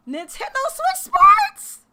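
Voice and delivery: high, loud excited shout; squealing with hype; hyped yell. Real, not acted. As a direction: yell it like a fan losing it. Hyped reveal of new game.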